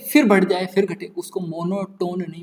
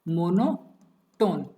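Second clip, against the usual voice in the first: "Monotone" broken in two, mono, a long pause, then टोन; split into parts; much slower than usual. Shown as a demonstration. Teacher breaking the word monotone down for students, split into parts. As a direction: say "monotone" very slowly with a gap after mono. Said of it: "monotone को तोड़ते हैं".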